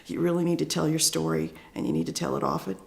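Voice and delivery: soft, slumped, self-doubting delivery; unconfident, slinking back. Shown as a demonstration. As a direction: say it small, as if you don't believe yourself. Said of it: "I kinda slinked back and my shoulders went down".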